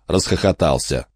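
ordinary talk, nothing marked

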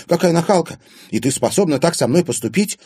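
high, loud gasp of outrage; indignant. A narrator's reading. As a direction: burst out in indignation at a friend. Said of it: "задохнулась от возмущения". (возмущённо, задыхаясь от негодования)

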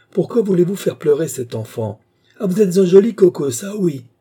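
high, loud motherly reproach; indignant scolding. A narrator's reading. Mother berates the worker for making her child cry, indignant. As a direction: tell him off sharply.

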